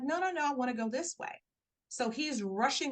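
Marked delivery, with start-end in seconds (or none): 0.0-1.3 higher, fast retold protest; mock-urgent objection, pitched up and hurried; quick protest. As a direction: rattle off the no's, brushing him off. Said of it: "I'm like".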